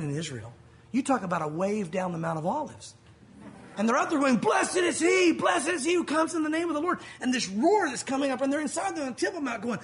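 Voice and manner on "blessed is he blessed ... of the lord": roaring crowd shout. Retold or imitated. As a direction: call it over a huge crowd. Crowd roaring praise at Jesus.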